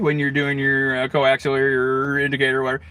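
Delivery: ordinary talk, nothing marked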